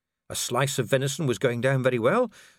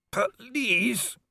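high pleading cry; desperate plea; 10 semitones higher than usual. Acted. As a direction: beg for your life. Werewolf begs for mercy.

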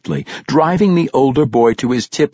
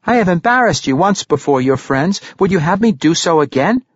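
taunting; 7 semitones higher than usual. A narrator's reading. Regis taunts the bully.

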